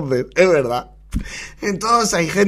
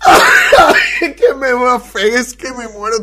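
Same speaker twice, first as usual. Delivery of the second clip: trying not to laugh; 5 semitones higher, 6 dB louder, slower than usual. Real, not acted. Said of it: "intento no reírme".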